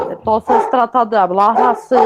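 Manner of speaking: "Entonces tratan de hablar así" spoken from the back of the throat; throaty voice. Shown as a demonstration. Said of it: "como si fueran a hablar así". (voz gutural, hablando con la garganta)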